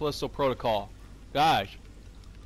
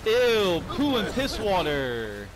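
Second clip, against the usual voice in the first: disgusted; 6 semitones higher, 4 dB louder, slower than usual. Real, not acted. Disgusted at sewer water.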